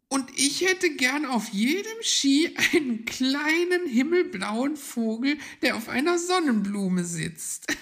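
high, loud figure voice ending in a giggle; delighted squeaky wish with a laugh. A narrator's reading. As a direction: gush very high, then laugh. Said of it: "lachte Putzi".

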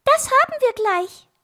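very high, loud birdlike voice; chirpy little bird. Acted. Little bird offers to fly up, high loud chirp. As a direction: squeak it like a tiny bird. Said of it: "lachte Zwitschi".

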